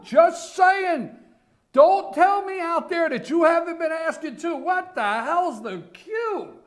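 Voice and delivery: loud, high-pitched shouting; worked-up yell, slowing on the last question; exasperated rant. Real, not acted. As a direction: shout it at the listeners in baffled exasperation.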